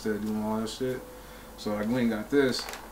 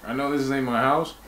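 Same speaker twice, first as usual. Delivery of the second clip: ordinary delivery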